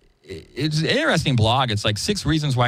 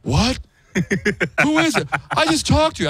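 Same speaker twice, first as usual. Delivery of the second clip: confused Baldwin. Retold or imitated. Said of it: "And he goes".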